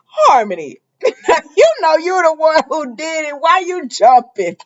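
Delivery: high, loud scolding through laughter; laughing disbelief. Real, not acted. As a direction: laugh as you call her out. Laughing while scolding a soap character.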